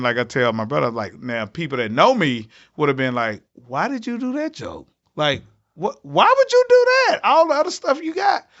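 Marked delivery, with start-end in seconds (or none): 3.7-8.5 a friend asking in disbelief; incredulous fans. Retold or imitated. Friends who know him ask in high disbelief.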